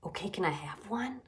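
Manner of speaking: soft, secretive request; hushed plea; whispered. A narrator's reading. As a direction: ask under your voice, furtively. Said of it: "he whispered again".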